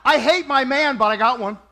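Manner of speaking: loud, laughing through the brag; laughing mock boast. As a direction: blurt the comeback while cracking up. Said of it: "and you're like".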